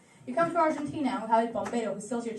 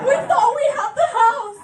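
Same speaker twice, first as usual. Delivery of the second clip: higher, louder hammed-up despair; mock outraged cry; 10 semitones higher, 10 dB louder than usual. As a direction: wail it like a betrayed homeowner. Acting outraged ghost victim.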